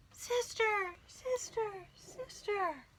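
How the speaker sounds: soft, high, far-off echo fading; echo from the cave. A narrator's reading. The cave echoes the duck's call softly. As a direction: sound like the cave answering back. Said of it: "A voice from the cave came back".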